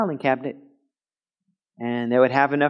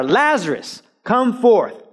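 raised, higher firm command; clear command; 8 semitones higher, slower than usual. Acted. Jesus commanding Lazarus out, firm and raised. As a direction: give the order, nothing else. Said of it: "The only words said are".

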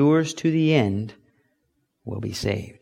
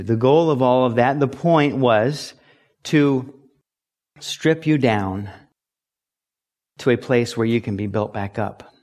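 ordinary talk, nothing marked

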